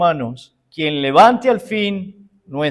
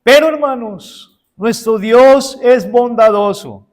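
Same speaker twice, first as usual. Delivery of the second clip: rising proclamation; 5 semitones higher, 5 dB louder than usual. Real, not acted. Preacher proclaims God's goodness.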